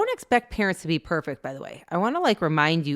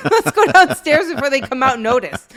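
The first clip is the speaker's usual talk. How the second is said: higher, louder, laughing through the words; laughing kid impression; 7 semitones higher, 7 dB louder than usual. Kids slipping off before parents notice, laughing.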